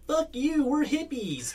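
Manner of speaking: high yelled hippie retort; hippie impression. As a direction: sneer it like a punk hippie. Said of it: "That's a great hippie impression".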